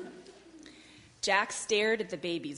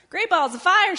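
high, booming-loud goose exclamation; excited cry; 12 semitones higher, 12 dB louder than usual. Acted. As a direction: holler it in high-pitched amazement. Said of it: "she cried".

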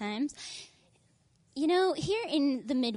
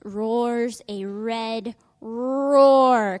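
slow, loud and drawn out; booming slow storybook line; dramatic roar line; 11 dB louder, much slower than usual. A narrator's reading. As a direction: roar the words to the kids. Dramatic read-aloud climax.

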